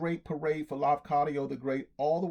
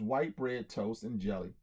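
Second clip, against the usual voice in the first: ordinary delivery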